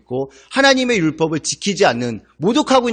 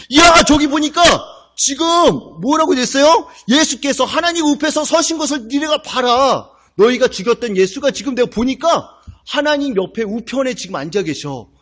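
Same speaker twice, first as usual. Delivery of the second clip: awestruck Stephen; 8 semitones higher than usual. Retold or imitated. Pastor voicing Stephen seeing heaven, amazed cry. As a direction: marvel at the vision.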